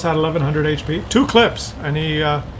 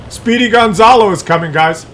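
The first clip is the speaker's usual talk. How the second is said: excited calling out; 7 semitones higher, 4 dB louder than usual. Real, not acted. Calling out an enemy tank.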